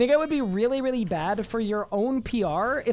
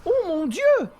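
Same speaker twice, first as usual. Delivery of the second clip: high, loud French-accented cry; French exclamation; 10 semitones higher, 6 dB louder than usual. Real, not acted. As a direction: burst out like a flustered Frenchman. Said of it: "I don't know why I become French".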